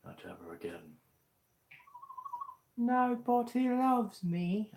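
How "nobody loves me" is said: high, loud, slow British crying voice; British accent. Acted. Streamer voicing a sad girl in a British accent. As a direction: wail it in a put-on British accent. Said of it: "I don't know why I made her British".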